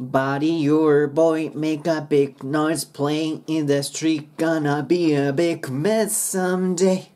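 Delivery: slow rhythmic English model with linked words; linked lyric pronunciation. Shown as a demonstration. Teacher modelling the opening lyrics for singers, slow and linked. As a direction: stretch "Body your boy" slowly, running the words together. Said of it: "では早速頭から見ていきましょう".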